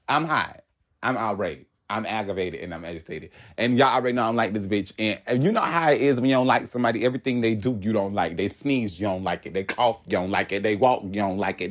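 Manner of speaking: ordinary talk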